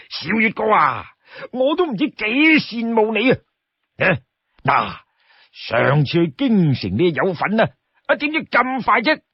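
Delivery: high-pitched, animated sworn brother; eager, wheedling Li Kui. Acted. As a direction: wheedle your brother with big swooping pitch. Storyteller plays wheedling Li Kui.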